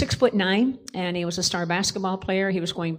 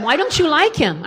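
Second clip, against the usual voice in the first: higher, louder surprised question, lightly voiced; surprised friend; 7 semitones higher, 6 dB louder than usual. Best friend quizzing her about the boy, higher and louder in surprise. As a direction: voice the friend pushing back, raised and louder.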